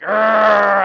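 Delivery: loud, drawn-out imitated growl. Imitating the gym teacher growling, a loud growl. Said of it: "you hear this, like, growl".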